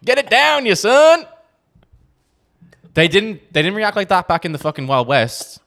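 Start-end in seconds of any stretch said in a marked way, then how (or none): 0.0-1.2 loud high whoop; excited cheering. Real, not acted. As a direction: cheer them on as the shot goes down. Cheering drinking a shot.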